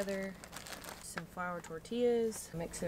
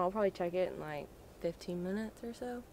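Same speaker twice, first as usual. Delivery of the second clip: usual speaking voice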